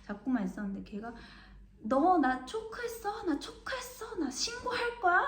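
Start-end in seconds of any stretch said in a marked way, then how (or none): 1.8-5.2 much higher, slowed, squealing protest; mock the boy screeching at her; shrill yelling teen. Boy in the chokehold shrieks accusations, high-pitched yelling. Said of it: "막 이렇게 소리를, 소리를 지르는 거예요".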